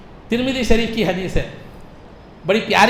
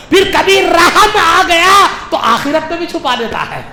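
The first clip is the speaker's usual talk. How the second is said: high, loud, ringing proclamation; full-force preaching; 7 semitones higher, 7 dB louder than usual. Real, not acted. Preacher proclaims that Allah's mercy outweighs his wrath, full-force preaching. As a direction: drive each clause home to a crowd.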